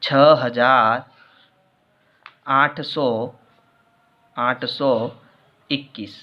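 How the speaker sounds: slow, word by word, with long gaps; slow dictation. Shown as a demonstration. Teacher dictating the number word by word while writing it. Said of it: "इसे हम शब्दों में लिखेंगे".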